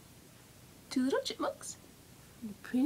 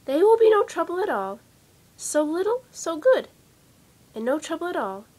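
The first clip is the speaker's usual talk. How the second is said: higher, louder, gushing mother chipmunk; sweet mother chipmunk; 5 semitones higher, 6 dB louder than usual. Acted. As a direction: coax the raccoon, all sweetness. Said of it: "said Mother Chipmunk".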